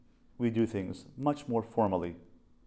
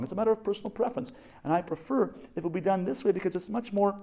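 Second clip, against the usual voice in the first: pompous lecturing husband; 9 semitones higher, faster than usual. Retold or imitated. Husband lecturing in imagined argument.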